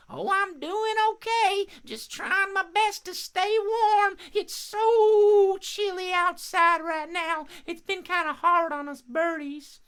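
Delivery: very high, drawn-out bird voice; friendly crow puppet. Pretend. Crow telling host about the cold, high puppet voice. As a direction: chat as a little cartoon crow.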